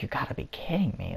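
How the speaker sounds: soft, disbelieving aside; hushed incredulous thought; inner thought. Retold or imitated. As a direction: mutter it to yourself in disbelief. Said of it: "I thought it in my mind".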